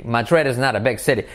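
fast, "not" tapped like "nar"; quick run-through. Shown as a demonstration. Teacher repeating the sentence at natural speed. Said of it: "Not se convierte en un R suave".

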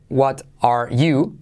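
"What are you" slowly, word by word, T pronounced; full form. Shown as a demonstration. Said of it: "se quedaría en". («What are you» lento, palabra por palabra)

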